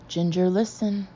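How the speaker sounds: ordinary delivery, nothing marked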